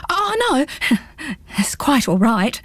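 high nervous refusal with a laugh; backing away hastily; nervous gulp. Acted. Pins nervously refuses the hedgehog's offer, gulping. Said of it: "gulped Pins".

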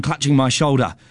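ordinary delivery

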